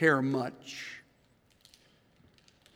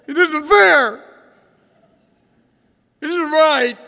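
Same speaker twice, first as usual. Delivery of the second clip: put-on much higher, louder childish wail; mock whine; 11 semitones higher, 11 dB louder than usual. Preacher mimics Elijah's complaint as a high, loud whine. Voicing Elijah's self-pity.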